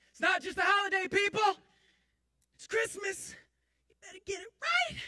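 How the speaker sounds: full, high-pitched yell. Retold or imitated. Man on the desk to store customers, yelling. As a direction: bellow it furiously into the microphone. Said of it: "I yelled into it".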